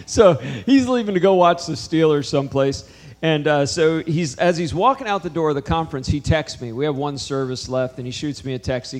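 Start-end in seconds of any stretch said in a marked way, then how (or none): none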